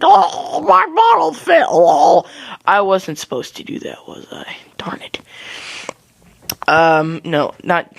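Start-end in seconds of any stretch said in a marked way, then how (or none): 0.0-2.2 high, loud bawling baby imitation; mock crying. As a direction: wail it like a toddler, hammed-up. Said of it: "I'm crying, I'm like".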